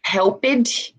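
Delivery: "helped" as help-id, rising; wrong extra syllable. Shown as a demonstration. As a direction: give "helped" a second syllable. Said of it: "So instead of".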